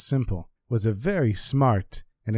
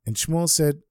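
plain reading voice

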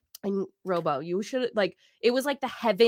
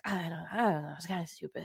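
timid mumble; 4 semitones lower, 9 dB quieter, faster than usual. Retold or imitated. Timid self-quote to mentor.

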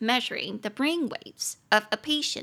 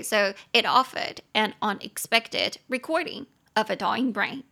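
ordinary talk

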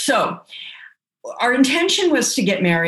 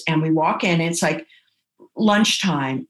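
normal talking voice

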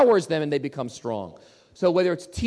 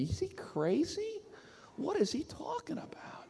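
incredulous whisper; 11 semitones higher, 14 dB quieter than usual. Retold or imitated. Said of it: "I say".

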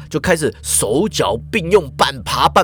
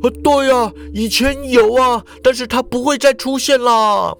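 higher man's voice, a bit louder; lightly raised, reluctant reply; Ikeda answering loudly; 8 semitones higher than usual. A narrator's reading. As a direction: shake it off, resigned. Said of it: "池田摇着头大声答道".

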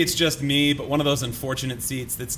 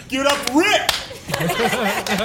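lone cheer; 9 semitones higher, much slower than usual. Retold or imitated.

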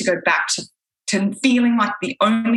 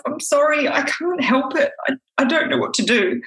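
upset apology; 8 semitones higher than usual. Retold or imitated. Pleading with husband, distressed.

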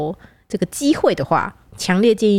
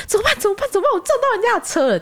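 higher and fast mock panic; panicked wife; 13 semitones higher, faster than usual. The wife frets about hitting the car, higher and fast. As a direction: fret quickly like a scared wife. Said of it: "他老婆也很紧张".

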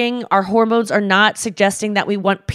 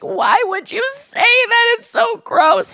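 much higher, whiny disgust; disgusted teen; slower than usual. Retold or imitated. Her teen self through the door to dad, high disgust. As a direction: squeal it like a grossed-out kid. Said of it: "And I was like".

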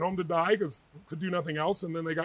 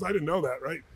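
ordinary delivery

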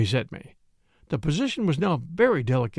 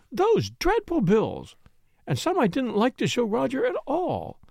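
higher, fluttering widow's voice; higher voice of an aggrieved widow; tearful Mrs Ackroyd; 8 semitones higher than usual. A narrator's reading. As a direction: plead like a flustered widow caught out.

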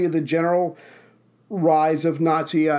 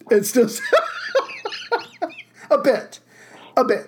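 laughing; 9 semitones higher than usual. Real, not acted. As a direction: giggle it out teasingly. Laughs about friend still stung.

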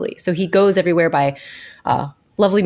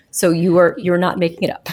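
ordinary talk

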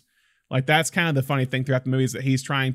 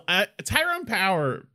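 usual speaking voice

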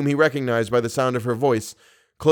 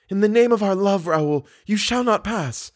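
impassioned plea; 9 semitones higher than usual. Acted. Woman barring the door to her lover, pleading high. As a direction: plead high, slowing on the final words.

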